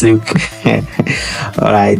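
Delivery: normal talking voice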